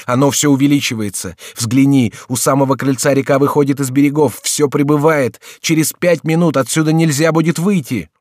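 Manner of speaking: high, urgent warning; pressing shouted warning; crying out. A narrator's reading. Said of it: "вскричал Генрих".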